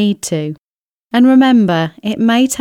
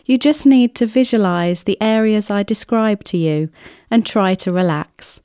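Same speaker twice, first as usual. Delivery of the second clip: ordinary talk, nothing marked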